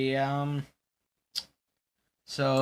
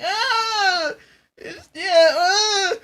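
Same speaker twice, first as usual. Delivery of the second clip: very high, loud hammed-up crying yelps; mock crying wail; 9 dB louder, much slower than usual. Imitating the other host crying, high loud mock wail. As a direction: wail like an anime hero in pain. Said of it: "the other's just crying".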